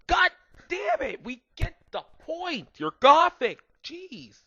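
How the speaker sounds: high, strained, shouted in a put-on voice; exasperated outburst. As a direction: lose it completely, screechy and fed up. Annoyed character exploding at goth talk.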